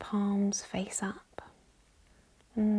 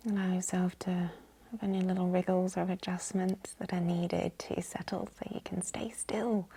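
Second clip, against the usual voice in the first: normal talking voice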